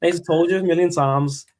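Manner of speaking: put-on Liverpool accent; football fan ranting in a hammed-up Scouse accent. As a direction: play a Scouse football pundit. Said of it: "your Scouse accent's pretty decent".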